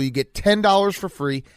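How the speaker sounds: normal talking voice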